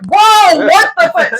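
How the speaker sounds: high, loud alarmed outburst; retold shocked exclamation; startled yell. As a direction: imitate guys jumping back in shock. Startled men acted out.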